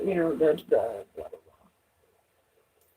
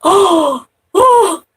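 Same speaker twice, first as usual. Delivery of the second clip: mock gasp; 12 semitones higher, 5 dB louder than usual. Imitating the startled gasp.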